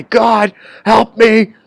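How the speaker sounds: loud, high cry for help; shouting to the sky. Acted. Imagined person to God in the sky, shouted plea. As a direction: call out loud and high, pleading to heaven. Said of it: "a person shouts to the sky".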